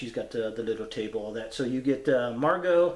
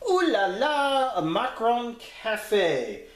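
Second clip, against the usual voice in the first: video intro announcer; 8 semitones higher than usual. Acted. Host greets YouTube with a new pickup video, high announcer voice.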